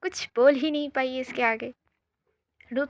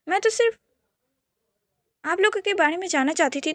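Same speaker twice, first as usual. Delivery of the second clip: higher, quick protesting; flustered defence; 6 semitones higher than usual. Acted. Daisy defending Rudra to her brother, high and flustered. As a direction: rush to defend him.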